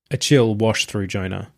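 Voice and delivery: ordinary delivery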